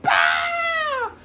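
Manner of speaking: sudden high shrieking cry; child's wail. Retold or imitated. Frightened little girl bursting into tears, high wail. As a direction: let out a startled sobbing wail. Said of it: "streams of water burst out of her eyes".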